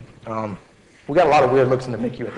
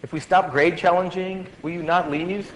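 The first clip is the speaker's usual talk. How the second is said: lightly voiced pleading student, raised pitch; a hint of a hopeful student, higher-pitched; student's plea; 6 semitones higher than usual. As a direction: imitate a student bargaining, rising at the end. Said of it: "A student asked me".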